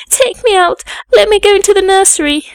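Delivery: very high, loud, hurried plea; desperate child begging to be let out; frantic cry. A narrator's reading. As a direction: cry out in panic, voice leaping up. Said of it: "was my cry".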